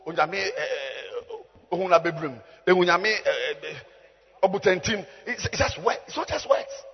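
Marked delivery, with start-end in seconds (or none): none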